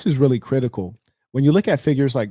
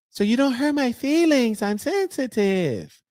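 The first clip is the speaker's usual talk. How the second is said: much higher, slow at sensitive, a mocking fragile voice; mock sensitive woman; 10 semitones higher, slower than usual. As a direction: parody a hurt, delicate listener. Mocking oversensitive listener.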